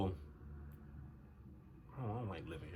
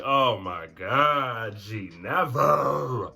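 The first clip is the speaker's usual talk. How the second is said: very slow, dragged-out retold groaning words; loud, drawn-out mock exasperation; exasperated; 8 dB louder, much slower than usual. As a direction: stretch each word out in exaggerated protest. Acted exasperated reaction to laughing.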